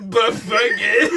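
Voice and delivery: losing it mid-phrase; cracking up. Real, not acted. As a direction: squeeze the words out through laughter. Cracking up at own story.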